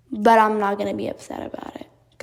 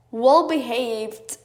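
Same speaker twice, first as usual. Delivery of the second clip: normal talking voice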